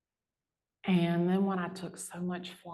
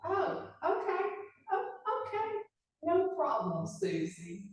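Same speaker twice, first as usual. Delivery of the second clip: sarcastic sweetness; 11 semitones higher than usual. Retold or imitated. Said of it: "She goes".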